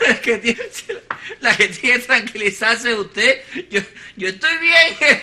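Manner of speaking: talking through laughter, a rising giggle on the last words; laughing. Acted. Alfredo brushes off the news to Teresita, talking through laughter. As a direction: laugh it off to the wife who just broke the news. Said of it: "usted desternillarse de la risa".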